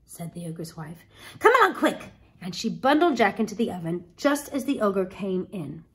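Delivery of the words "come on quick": high, loud hurried order; urgent alarm. Acted. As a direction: hustle him into hiding. Wife hurries Jack into hiding.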